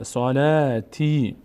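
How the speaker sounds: صلاتی slowly, "laa" and "tee" held; long vowels. Shown as a demonstration. Teacher showing ta before ya, long vowels. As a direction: stretch لا and تی in صلاتی.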